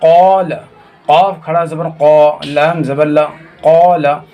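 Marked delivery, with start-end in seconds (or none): 0.0-0.5 क़ाल with a long, stretched aa; drawn-out vowel. Shown as a demonstration. Teacher showing how alif is read, क़ाल with stretched aa. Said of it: "जिस तरह से खींच कर पढ़ेंगे". 3.6-4.2 क़ाल with a long, held aa; drawn-out vowel. Shown as a demonstration. Teacher showing khara zabar sounds like alif, क़ाल with stretched aa. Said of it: "उसी तरह से खड़ा ज़बर को भी पढ़ेंगे".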